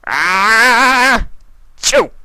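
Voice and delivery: loud high spoken sneeze; big sneeze. A narrator's reading. Discord sneezes cookies across the table, loud. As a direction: belt out an "ah-chew".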